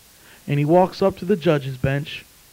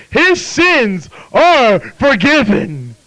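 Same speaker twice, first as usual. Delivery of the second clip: drawn out and raised in pitch; slow, deliberate declaration; 6 semitones higher, 6 dB louder, much slower than usual. Acted. Preacher voicing the verdict from the book, slow and deliberate. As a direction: proclaim it word by word, very slowly.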